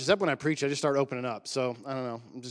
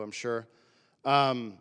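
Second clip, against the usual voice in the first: usual speaking voice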